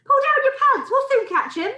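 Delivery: high, loud shouted instruction. A narrator's reading. Cook telling the man how to catch it, shouted. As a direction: call it high, urging him on. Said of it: "someone else shouted".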